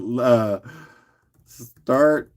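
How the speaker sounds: usual speaking voice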